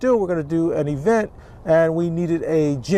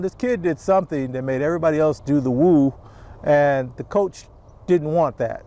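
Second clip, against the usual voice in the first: normal talking voice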